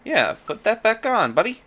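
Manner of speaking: high, teasing scold; mock scolding. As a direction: tease him like a nosy friend. Scolding the game character.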